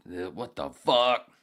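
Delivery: incredulous drawl. Retold or imitated. Imitating straining at urinal.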